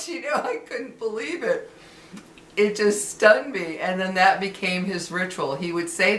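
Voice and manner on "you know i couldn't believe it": laughing through the words, higher; real amused laughter. Real, not acted. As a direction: still giggling in disbelief. Laughing at the memory.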